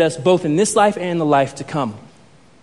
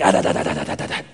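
low staccato "ah-da-da-da" scolding noise; Mister Miyagi; 3 dB quieter, much faster than usual. Retold or imitated. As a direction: cut the pupil off with rattled syllables. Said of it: "Mister Miyagi's like".

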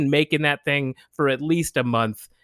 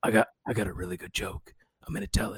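low, much softer, odd confiding voice; lightly sketch a strange actor's aside; Nick Cage voice; 4 semitones lower, 9 dB quieter, faster than usual. Retold or imitated. Imitating Nick Cage announcing his joke, low and soft. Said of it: "Nick Cage just comes in and he's like".

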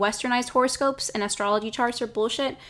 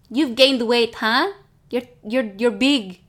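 blunt auntie; 5 dB louder, slower than usual. Retold or imitated. Mocking blunt relative.

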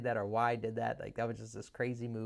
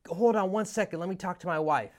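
high and louder, halting the call; hesitant Ken; 6 semitones higher, 7 dB louder than usual. Retold or imitated. Ken putting the caller on hold to ask his wife, high louder voice. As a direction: stop the caller short, raised voice.

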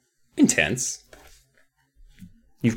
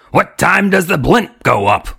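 raspy, higher dummy voice; nasty rasp; 5 semitones higher, 4 dB louder than usual. A narrator's reading. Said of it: "he rasped nas-, nastily".

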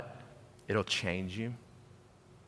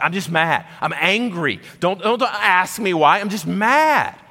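mock angry outburst, climbing higher and louder; flaming mad; 8 semitones higher, 7 dB louder than usual. Father imitating his mad daughter refusing questions, flaming mad. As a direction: act out a furious daughter, building to a loud peak on the last word. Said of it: "She was flaming mad".

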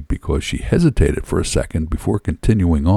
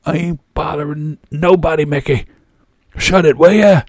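high, strained, furious yelling; exasperated shout, louder at the end; angry bellow; 10 semitones higher, 4 dB louder than usual. A narrator's reading. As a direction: bellow back red-faced with rage.

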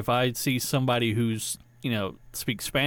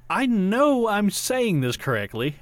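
higher, drawn-out protest; exasperated insistence; 8 semitones higher, slower than usual. Retold or imitated. Learner protesting about Chinese not understood, exasperated insistence. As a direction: insist it slowly, pitched up. Frustrated with being misunderstood.

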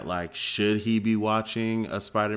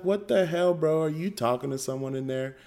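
creeped-out disbelief; 6 semitones higher than usual. Retold or imitated. Dad acts out creeped-out questioning.

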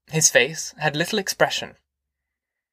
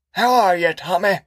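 put-on old man voice; 5 semitones higher, 3 dB louder than usual. A narrator's reading. Grandpa voiced.

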